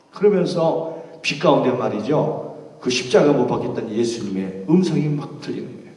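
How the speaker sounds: ordinary talk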